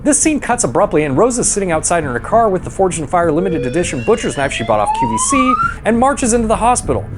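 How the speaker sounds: ordinary talk, nothing marked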